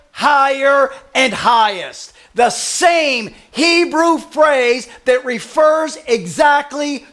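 emphatic preaching. Real, not acted. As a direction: drive the point home word by word. Preacher builds to his main point.

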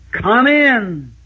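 high, drawn-out Kentucky welcome; Kentucky Southern "Come in" called out high; Kentucky drawl. Retold or imitated. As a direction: call it out like an old Kentucky host. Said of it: "I heard the old Kentucky expression".

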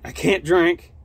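ordinary delivery

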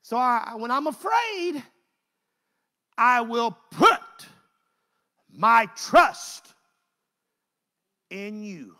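loud, slow, emphatic pulpit delivery; preaching at full force. Acted. Preacher to congregation, declaiming the psalm loudly. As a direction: proclaim each phrase to the church.

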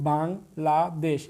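Bangladesh slowly split into three syllables, with gaps. Shown as a demonstration. Teacher showing students its syllable count, broken up slowly. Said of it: "ভেঙে ভেঙে যে কয়েকবার উচ্চারণ".

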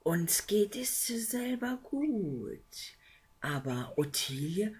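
water man answering the visitor; Wassermann voice. Acted. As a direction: speak like an old water spirit.

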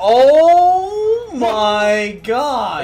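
loud, drawn-out amazement; shocked exclamation. Real, not acted. Shocked reaction to the video.

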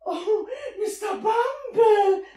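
high, slow gushing call; flustered greeting. Acted. Matron greeting the beadle at the door, flustered. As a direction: greet him all aflutter.

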